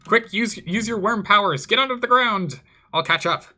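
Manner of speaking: high, loud battlefield shout; urgent calling out. Pretend. Player shouts to Sir Robard to flee, urgent calling out. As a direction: shout urgent orders to a companion. Calls urgent orders to fleeing companion.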